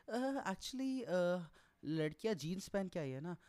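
high, hesitant put-on colleague voice; colleague's excuse. Colleagues explaining the girls wore jeans, in a high sheepish voice.

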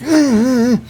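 high, strained repeated wordless cries; strangled cries. Retold or imitated. Intubated patient pleading wordlessly with his doctor, high strained cries. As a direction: push out choked "ah"s like you can't speak. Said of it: "he's, like, looking at me like".

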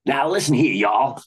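bellowed American cowboy drawl; fake cowboy drawl. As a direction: ham up a Western cowboy accent. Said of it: "bellowed Norman in his fake cowboy drawl".

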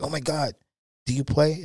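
high amazed question, a touch mocking; retelling the kid's astonishment. Kid at the ping pong table asking the newcomer, surprised.

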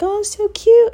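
high squeal over cute puppies; high-pitched cute-puppy squeal; cooing. Retold or imitated.

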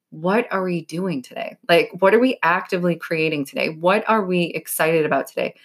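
ordinary talk, nothing marked

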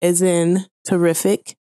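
letter by letter, slow and careful; spelling it out. Shown as a demonstration. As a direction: spell each letter clearly. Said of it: "let me spell it for you".

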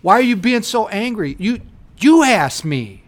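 loud, high ranting back-and-forth; mock heated argument. Acting out a blow-up with a nosy guest, loud and high. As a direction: blow up as if cornered.

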